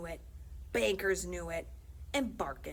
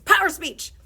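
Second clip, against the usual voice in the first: loud; 10 semitones higher, 17 dB louder than usual. Acted. Principal snaps at salesman.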